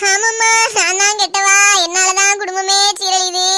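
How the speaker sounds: slow, loud mock confession; sarcastic self-blame. Mother-in-law sarcastically blaming herself, slow. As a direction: drag it out in bitter sarcasm.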